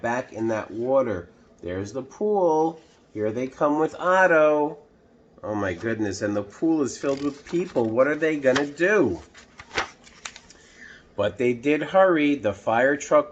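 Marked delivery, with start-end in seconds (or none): none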